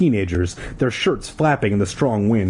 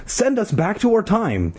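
high, impatient order; acted impatience; 11 semitones higher than usual. A narrator's reading. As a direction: insist impatiently, higher pitch. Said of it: "Ashley repeated impatiently".

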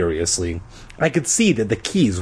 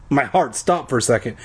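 usual speaking voice

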